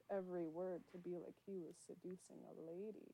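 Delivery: soft, seductive bedroom voice; Bob Ross. Retold or imitated. Host describing how the painter spoke to viewers, soft bedroom voice. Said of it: "using his sexy bedroom voice".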